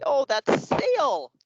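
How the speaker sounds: high, drawn-out delighted answer; kids guessing. Acted. Kids working out the turtle's word, high and excited. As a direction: call it out high, stretching the last word. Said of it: "And then the kids will say".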